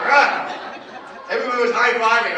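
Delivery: loud laughing delight; laughing triumph. Real, not acted. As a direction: burst out with the thrill of winning. Crowd win, speaker laughing.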